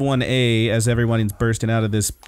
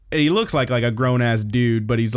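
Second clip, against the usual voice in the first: usual speaking voice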